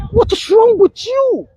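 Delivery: high, slow, crying shout; wife's anguished outcry, voiced by the pastor; exasperated cry. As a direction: wail it out in disbelief. Preacher mocks exasperated wife.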